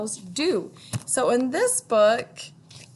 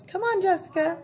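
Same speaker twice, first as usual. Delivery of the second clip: put-on much higher, coaxing child voice; Ruthie's voice; 9 semitones higher than usual. A narrator's reading. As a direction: reassure a scared friend. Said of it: "she says".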